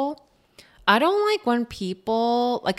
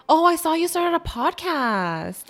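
mocking annoying acquaintance; 9 semitones higher than usual. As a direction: drag it out like a fake-nice busybody.

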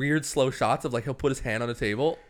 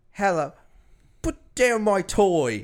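high, loud kid's attempt at American slipping into Irish; slipping Irish-English accent; 8 semitones higher, 6 dB louder, slower than usual. Mocking child actors failing at American accents, slipping Irish-English accent. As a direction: mimic a child's Irish-English accent breaking through, drawn out. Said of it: "trying to do American accents".

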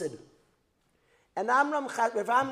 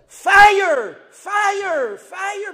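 high, loud alarm cry of fire; screaming fire; 12 semitones higher, 15 dB louder, much slower than usual. Retold or imitated. Rabbi on the ladder calling the neighbors, high loud shout. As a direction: yell it out to wake the whole street. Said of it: "And he starts screaming".

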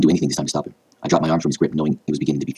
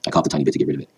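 usual speaking voice

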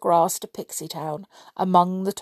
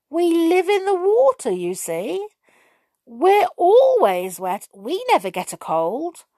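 higher-pitched, plaintive explanation; mildly sorrowful; 9 semitones higher, 4 dB louder than usual. A narrator's reading. Trickle tells fairies the sprites live in water, mildly sorrowful. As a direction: explain with a touch of hurt. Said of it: "said Trickle sorrowfully".

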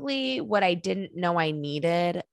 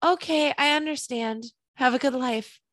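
higher-pitched movie-heroine quote; imitated breakup call; 6 semitones higher than usual. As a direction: lightly act her polite goodbye, pitched up. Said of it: "you just hear her go".

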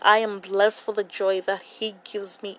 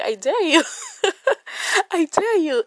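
laughing, teasing dare, higher and louder; playful dare; 7 semitones higher, 7 dB louder than usual. Real, not acted. Preacher daring the congregation to try God, laughing. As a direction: challenge them with a laugh.

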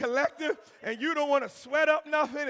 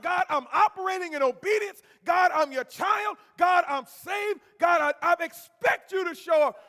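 loud, high, insistent declaration to God; shouted bold prayer; 5 semitones higher, 6 dB louder than usual. Pretend. Preacher voicing a believer claiming God's help, shouting. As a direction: demand that God show up, full voice.

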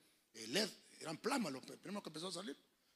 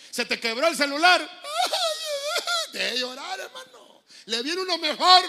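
loud, higher laughing taunt; mocking laughter; 12 semitones higher, 13 dB louder than usual. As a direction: laugh at the crybaby. Imita al llorón riéndose. (burla entre risas)

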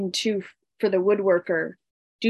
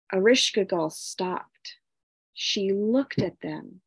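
ordinary delivery, nothing marked

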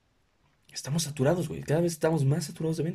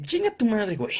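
louder high mock outburst; mock furious reply; 9 semitones higher, 4 dB louder than usual. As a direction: yell back at the app in jest. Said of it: "te mando un mensaje así de". (grito burlón contra la app)

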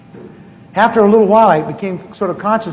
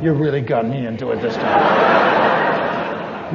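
laughing aside; 9 semitones lower, 4 dB quieter, slower than usual. Retold or imitated.

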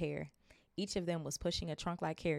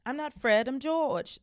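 higher and louder, mock outrage; indignant twin; 9 semitones higher, 8 dB louder, slower than usual. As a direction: protest the mix-up cheekily. Twin protesting to mother.

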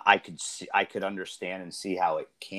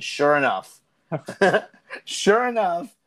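laugh, then high-pitched gleeful delivery; tickled, voice leaping high with laughter; amused laughing; 9 semitones higher, 5 dB louder than usual. Real, not acted. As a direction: say it through a real laugh, pitched way up. Amused at fans proved wrong.

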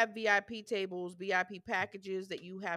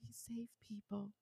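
whisper; 10 dB quieter than usual. Pretend. Whispered safety message.

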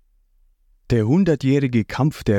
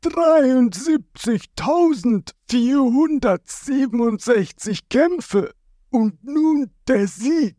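high, slow, tear-choked giant voice; tearful triumph; 13 semitones higher, slower than usual. A narrator's reading. Victorious giant weeps over his fallen rival, high and slow. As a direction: drag out each word, weeping with joy. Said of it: "Tränenüberströmt freut sich Boogie".

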